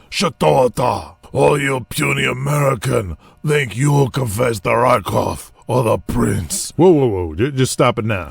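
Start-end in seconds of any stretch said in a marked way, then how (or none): 0.1-6.7 put-on a lightly raspy, slow, failed Russian accent; bad fake Russian accent. Wrestler trying a Russian heel voice, badly raspy. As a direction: growl out a clumsy fake Russian villain line. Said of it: "I have to talk all raspy".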